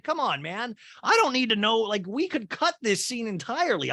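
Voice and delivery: usual speaking voice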